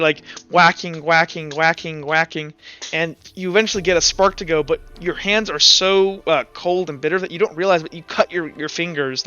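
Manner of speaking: ordinary delivery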